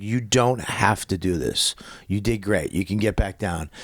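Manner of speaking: low, soft fatherly voice; gentle reassurance. Retold or imitated. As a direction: quietly let a frightened kid off the hook. Reassuring his crying son.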